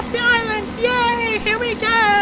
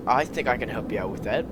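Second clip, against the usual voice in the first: much lower, softer hidden stranger; mysterious low voice; 8 dB quieter than usual. Acted. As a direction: speak low from the shadows. Mysterious unseen voice.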